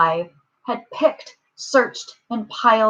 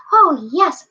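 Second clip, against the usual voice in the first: high little piped voice; piping; 7 semitones higher, 4 dB louder than usual. A narrator's reading. Speck mayor answering Horton, piping high. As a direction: pipe up as a tiny being. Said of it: "piped the voice".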